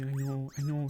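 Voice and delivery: normal talking voice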